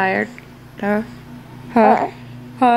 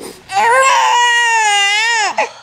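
high-pitched helpless laughing; worn-out mother cracking up; high giddy laugh; 10 semitones higher than usual. Real, not acted. Mother laughs at cranky baby.

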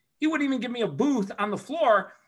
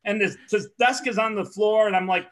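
ordinary delivery

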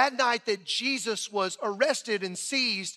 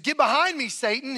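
raised, louder retold rebuke; stern rebuke; 4 semitones higher, 5 dB louder than usual. Jesus rebuking Peter for his words, stern and raised. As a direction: cast him off with sudden force.

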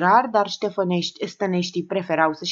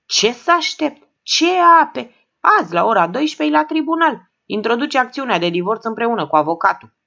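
strained, higher, louder and cracked; hoarse strained cry, higher; 6 semitones higher, 6 dB louder than usual. A narrator's reading. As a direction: force it out through a cracked throat. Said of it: "glasul sună dogit".

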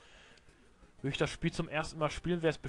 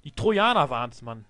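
gespielt empört; 9 dB louder than usual. Acted. Schiebt alles auf Trojaner.

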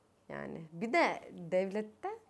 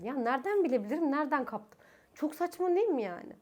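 exasperated; 6 semitones higher than usual. Real, not acted. Mocking answer to callers.

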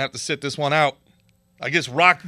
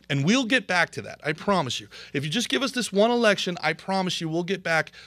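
ordinary delivery

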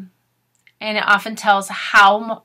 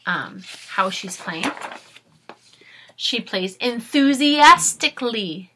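lively storybook read-aloud; reading to children; 2 semitones higher, slower than usual. Acted. Teacher reading a storybook aloud to her class, lively. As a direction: read it brightly to young listeners. Reads picture book to class.

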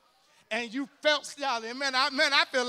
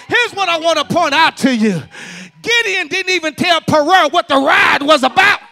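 preaching at full force; 3 semitones higher, 21 dB louder than usual. Acted. Preacher closing on the ride-or-die friend, shouting. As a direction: hammer it home to the congregation.

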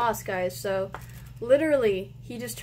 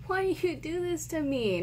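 ordinary delivery

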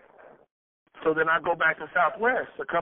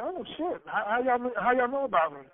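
surprised; 6 semitones higher than usual. Retold or imitated. Surprised at being known.

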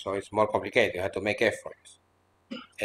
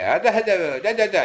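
lightly voiced wife chattering, higher; mock Hindi babble; 9 semitones higher, 4 dB louder than usual. Imitating a wife chattering to her husband in Hindi-like sounds. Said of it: "yes, in Hindi".

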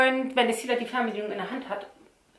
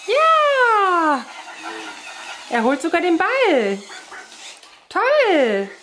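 excited; 4 semitones higher, much slower than usual. Real, not acted. Robot dog fetches ball.

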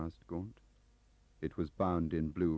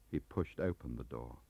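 ordinary delivery

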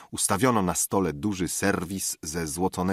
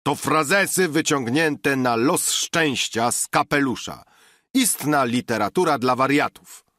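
raised voice, higher and louder; okrzyk znawcy; 7 semitones higher, 5 dB louder than usual. A narrator's reading. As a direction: shout it dismissively across tables. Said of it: "krzyknął znawca od drugiego stołu". (podniesiony, wyższy głos)

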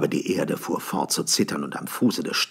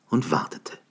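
usual speaking voice

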